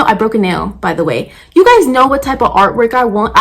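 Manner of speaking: ordinary talk, nothing marked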